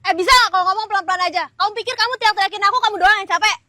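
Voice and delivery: loud, rapid-fire telling-off; heated, rushed complaint; angry scolding. Acted. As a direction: scold fast at full voice. First take, she scolds.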